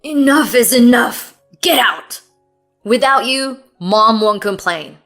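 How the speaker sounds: loud shouted dismissal; furious. Acted. Husband throwing wife out, furious. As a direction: throw her out with your voice.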